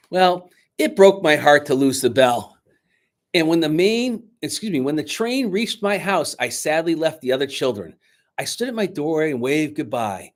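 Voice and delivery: lively read-aloud narration; storyteller reading a Christmas book aloud; story narration. A narrator's reading. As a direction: tell it to a room of children. Reads story aloud to children.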